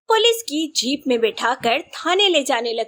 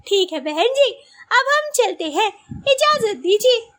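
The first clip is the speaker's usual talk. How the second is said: put-on higher-pitched, gracious older relative's voice; high, sociable matriarch voice; pleased mother-in-law-to-be; 7 semitones higher than usual. A narrator's reading. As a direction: approve of the bride in a high, delighted voice.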